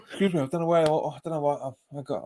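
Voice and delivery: talking through a yawn; words stretched by a yawn. Real, not acted. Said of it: "I'm yawning all of a sudden".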